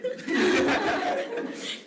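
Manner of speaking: breathy high giggle; high airy laugh. Real, not acted. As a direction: laugh at your own joke. Laughs at own joke.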